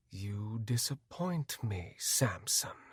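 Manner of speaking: slow, soft, fading into a whisper; quiet, hurt whisper to Samson; disappointed hush. A narrator's reading. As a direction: let him down gently, barely voiced. Friend leaves, disappointed.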